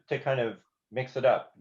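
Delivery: ordinary delivery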